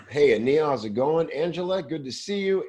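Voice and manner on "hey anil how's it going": normal talking voice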